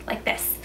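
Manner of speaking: wet, slushy S in like this; slushy lisp. Shown as a demonstration. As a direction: air spilling out the sides on this. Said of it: "sounds kind of wet and slushy".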